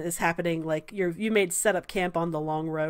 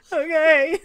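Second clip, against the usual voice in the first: high, loud, rising nervous okay; acted jittery acceptance; 9 semitones higher, 6 dB louder than usual. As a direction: squeak out an uneasy agreement. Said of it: "Sal looks so nervous".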